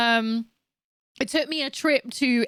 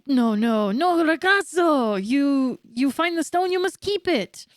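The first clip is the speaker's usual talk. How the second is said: hammed-up Italian accent, lilting and higher; bad Italian accent; 4 semitones higher than usual. Old sailor giving up his sunken treasure, hammed-up Italian accent. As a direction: play it as a theatrical, badly faked Italian. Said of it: "I don't wanna do an Italian accent".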